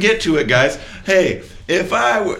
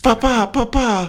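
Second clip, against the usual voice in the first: high put-on kid voice; child calling papa; 7 semitones higher than usual. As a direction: call to papa as a small child. Second improviser plays child to papa.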